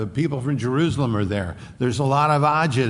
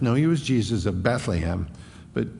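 ordinary delivery

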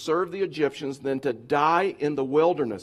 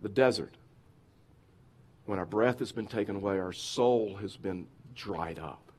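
quiet reflective preaching; 8 semitones lower, 7 dB quieter than usual. Real, not acted. Preacher asking the congregation, low and hushed. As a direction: draw them in, hushed and searching.

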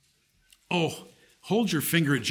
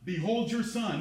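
softer, gentle and sincere; tender; 4 semitones higher, 7 dB quieter than usual. Shown as a demonstration. Preacher voices Jesus tenderly entrusting Mary to John. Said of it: "So it's".

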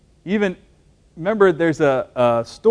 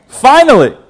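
mock preacher; 8 semitones higher, 11 dB louder than usual. Pastor mocking preachers who never finish, grand mock "Finally.". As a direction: belt "Finally." as a hammed-up sermon ending. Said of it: "A typical preacher thing to do".

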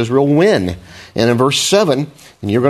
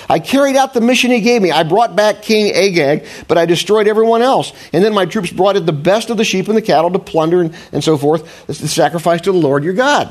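rationalizing; 7 semitones higher than usual. Retold or imitated. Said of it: "He says that again".

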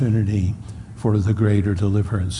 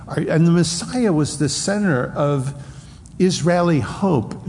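usual speaking voice

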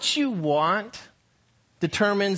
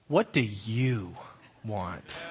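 low, slow, dropping to a near-whisper on "want"; zapping question; 9 semitones lower, 6 dB quieter, much slower than usual. Shown as a demonstration. Pastor zapping the listener with the question, low and slow. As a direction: let it sink in low and slow, like a jab. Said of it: "Or I could ask it this way".

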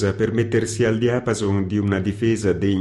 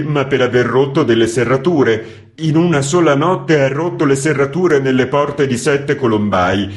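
loud, high pleading complaint; contrite typesetter; 6 semitones higher, 6 dB louder than usual. A narrator's reading. Typesetter excusing himself to the editor, loud and pleading. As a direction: plead your innocence, aggrieved.

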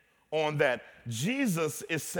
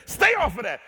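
mock yell; 8 semitones higher, 9 dB louder than usual. Mocking a man guarding the playground.

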